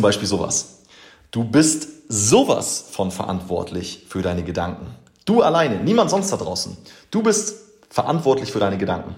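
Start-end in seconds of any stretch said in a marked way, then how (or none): 1.3-4.9 sowas deutlich hervorgehoben, nachdrücklich; drück das sowas ganz fest; Betonung auf sowas. Real, not acted. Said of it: "bringen mich echt auf die Palme". 5.2-9.1 höher und lauter, energisch; leidenschaftlicher Nachdruck. Real, not acted. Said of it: "bringen mich echt auf die Palme".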